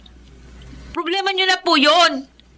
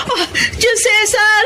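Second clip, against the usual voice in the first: usual speaking voice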